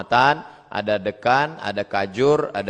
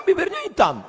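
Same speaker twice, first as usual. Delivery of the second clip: mock indignant shout. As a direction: shout the punchline back at the examiner. Membalas penguji dengan lucu. (teriakan protes lucu, tinggi dan keras)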